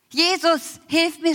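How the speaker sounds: crying out to Jesus in desperation. Retold or imitated. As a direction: call out to Jesus for help. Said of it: "schrie ich endlich wieder".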